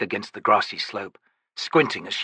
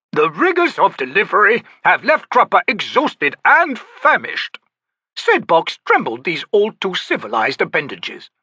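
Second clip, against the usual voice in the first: lofty, theatrical, higher; grand old Daru; 10 semitones higher, 5 dB louder than usual. Acted. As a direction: play the eccentric old trickster. Cropper exhausted.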